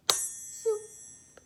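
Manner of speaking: high, unvoiced zoop swoop; magic zoop. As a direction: whoosh it away like magic. Magic wand sound.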